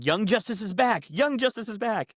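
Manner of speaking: mild mock yell, pitched high; high-pitched announcement as Bart screaming; retold scream. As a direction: imitate an excited scream without much volume. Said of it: "Bart basically just starts screaming".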